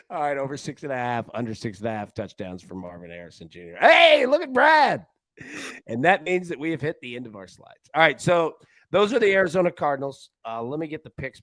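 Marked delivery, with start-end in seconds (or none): 3.8-5.0 calling out. Real, not acted. Calls out to Brad.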